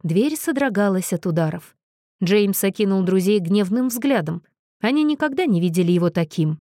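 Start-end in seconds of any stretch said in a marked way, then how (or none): none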